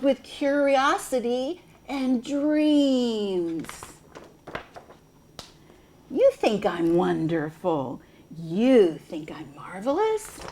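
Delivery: reading a picture book to preschoolers; gentle sing-song read-aloud; storytime voice. Acted. Reads picture book aloud to children.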